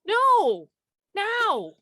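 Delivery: loud, high urgent yell. Real, not acted. Clue-giver to guessing partner in a timed game, yelling. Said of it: "He just kept yelling".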